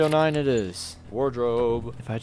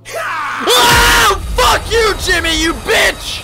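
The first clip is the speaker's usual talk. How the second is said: very high, loud shrieking; terrified screaming; 11 dB louder, slower than usual. Real, not acted. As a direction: scream after a jump scare. Jump scare by a friend.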